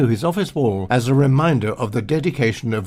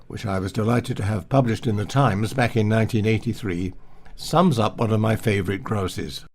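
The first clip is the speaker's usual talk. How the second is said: usual speaking voice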